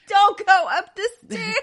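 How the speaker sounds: laughing yell. Acted. Yelling at horror film heroine.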